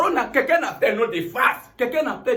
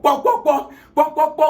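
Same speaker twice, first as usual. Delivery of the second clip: loud, higher-pitched spoken knocks; imitated door knocking; 5 semitones higher, 5 dB louder than usual. Visitor at Philip's house, imitated knocking and calling. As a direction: knock with your voice, then call out. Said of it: "na him knock".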